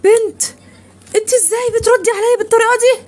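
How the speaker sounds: mother yelling at her daughter for talking back; furious shout. Acted. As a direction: blast it at your daughter.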